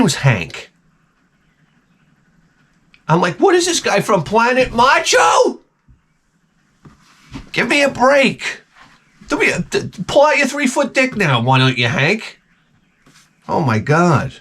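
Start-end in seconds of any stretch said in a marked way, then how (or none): 3.4-12.4 mocking outrage. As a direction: ham up the disbelief at Planet Macho. Mocking the macho guy.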